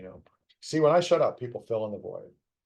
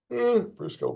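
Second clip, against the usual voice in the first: yawning; 12 semitones higher, 8 dB louder than usual. Real, not acted. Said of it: "please excuse me for yawning".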